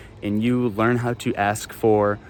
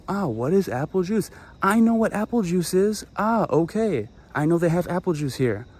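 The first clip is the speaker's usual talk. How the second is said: excited tourist; 6 semitones higher than usual. Retold or imitated. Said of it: "You hear".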